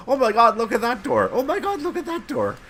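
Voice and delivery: high squealy gushing over a door, twice; mock gushing friend. Friend raving at doors on the walk, squealing. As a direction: gush at every door like a giddy tourist. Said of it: "to go".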